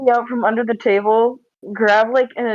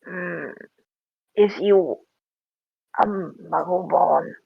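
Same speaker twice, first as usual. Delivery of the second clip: creature voice; 5 semitones lower, 3 dB quieter, much slower than usual. Acted. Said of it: "be like".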